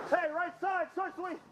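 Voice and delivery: shouting orders over battle. Real, not acted. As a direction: call out loud to teammates. Calling to the right flank.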